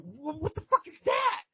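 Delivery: high, alarmed disbelief; a newbie blurting out shock, pitched high; shocked question. Retold or imitated. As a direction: squeak it out, startled and lost. Shocked prison newcomer repeats.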